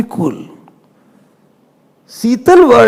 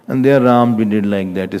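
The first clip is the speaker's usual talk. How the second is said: usual speaking voice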